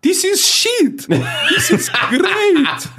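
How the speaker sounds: high, slow, far-off exclaiming voice; gleeful exclaim. Retold or imitated. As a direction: gush it out slowly, way up high. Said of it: "er sich denkt".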